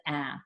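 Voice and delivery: "ah" said open and short, like the a in apple; short a sound. Shown as a demonstration. Teacher showing the class the short A, a clear "ah". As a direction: give the pure short "ah" of apple. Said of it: "We know that A says".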